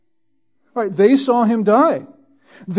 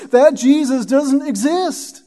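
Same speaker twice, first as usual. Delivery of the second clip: higher, slow and deliberate; slow, higher-pitched angelic declaration; angel's voice; 6 semitones higher, slower than usual. Acted. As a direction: speak it slowly, like the angel correcting them. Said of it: "and the angel says".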